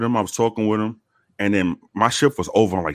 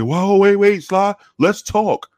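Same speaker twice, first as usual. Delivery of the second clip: high, louder nervous "whoa, wait, let's talk"; mock placating plea; 12 semitones higher, 5 dB louder, much slower than usual. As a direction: play the whole world suddenly begging nicely. Everyone suddenly placating an armed man.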